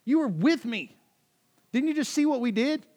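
loud, very high-pitched incredulous rant; frustrated Jesus. Acted. Jesus to his clueless disciples, frustrated. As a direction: cry out in total exasperation. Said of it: "That's Jesus in this moment to his disciples".

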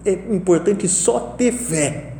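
a hint of grandma's last whisper-thin words; weak dying grandmother. Grandson retelling his dying grandma's last words, slow frail voice. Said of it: "Não tinha voz quase".

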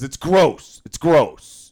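mock disgusted supervisor. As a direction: sneer the word like a fussy boss. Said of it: "he goes".

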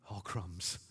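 low whispered aside, as a private thought; mock guilty whisper to yourself; hushed, sheepish realisation; whispered dismay. Acting a guilty reader's thought.

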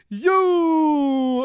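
hammed-up Hogan shout; Hogan yell. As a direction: bark it like a wrestling showman. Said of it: "He's like".

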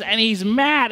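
ordinary delivery

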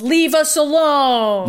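drawn-out mock holler; imitated yell. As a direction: imitate someone yelling, stretching every word. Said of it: "he yells".

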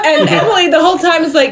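normal talking voice

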